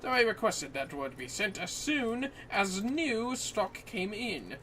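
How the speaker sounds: lightly voiced formal scholar, measured and courteous; NPC quest-giver voice. Streamer voicing the quest NPC to her co-op partner, lightly formal. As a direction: read it like a polite old-world sage.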